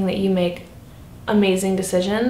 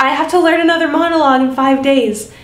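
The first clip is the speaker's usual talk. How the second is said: mock panic; 5 semitones higher, 6 dB louder than usual. Acted panic over new task.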